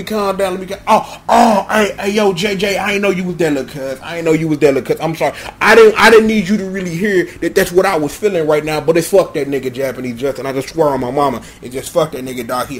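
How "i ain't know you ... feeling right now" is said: normal talking voice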